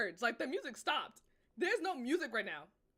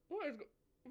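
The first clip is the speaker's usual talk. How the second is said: gasping shock; 3 dB quieter, slower than usual. Real, not acted. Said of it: "gasps in shock".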